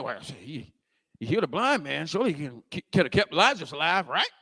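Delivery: low, muted scoffing; scoffing bystanders. Acted. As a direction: grumble doubtfully as a mocking onlooker. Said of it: "The other people saying".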